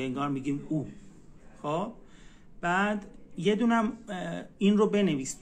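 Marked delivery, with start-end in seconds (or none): 0.7-0.9 او as a rounded back "u" vowel; Turkish U. Shown as a demonstration. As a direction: say او as Persian "oo". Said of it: "اوی همون فارسی دیگه".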